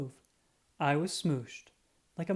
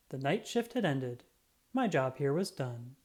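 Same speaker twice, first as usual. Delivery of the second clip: ordinary delivery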